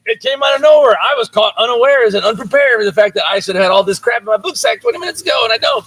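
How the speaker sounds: high-pitched, exaggerated surprise; mock flustered daughter. Daughter arriving at school unprepared, hammed-up astonishment. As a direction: play a child caught totally off guard. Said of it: "she always acts like".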